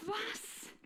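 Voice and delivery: squeaky disbelieving what; high stunned what. Retold or imitated. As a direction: pitch jumps up in disbelief. Third person's praise stuns her.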